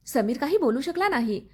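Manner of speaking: ordinary delivery, nothing marked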